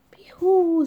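high, loud whooping call. A narrator's reading. Muminek hails Ryjek over his find, calling out. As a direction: whoop it out to a friend. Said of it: "zawołał Muminek".

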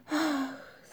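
high, soft gasp of wonder; delighted gasp. A narrator's reading. Ryjek sees the snow globe, gasping with delight. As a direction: breathe out in delight. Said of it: "zawołał Ryjek z zachwytem".

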